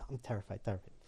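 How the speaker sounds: very soft, low terrified murmur; hushed aside. Retold or imitated. Newcomer admitting his fear to himself, hushed. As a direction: drop right down to a frightened undertone.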